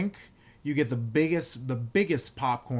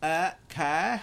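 lightly voiced Valley Girl, higher pitch; 5 semitones higher than usual. Wife tries a Valley Girl on request, hesitant mock voice. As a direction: have a reluctant go at a Valley Girl. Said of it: "give me your best Valley Girl".

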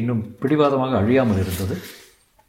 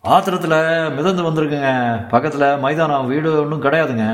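lightly voiced constable, raised at the start; policeman reporting; 5 semitones higher, 3 dB louder than usual. Policeman radioing in a body found floating, official. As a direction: report the find over the radio.

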